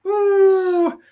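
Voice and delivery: very high, hooting comic Irish; Irish accent. Shown as a demonstration. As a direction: open on a high stage-Irish whoop. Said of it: "Whenever I do Irish".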